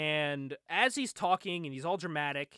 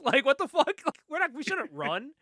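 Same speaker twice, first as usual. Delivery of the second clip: high-pitched incredulous objection; retold indignant protest; 8 semitones higher than usual. Comic retelling Panda's refusal to run, indignant protest. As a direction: push back in a higher, lightly laughing voice.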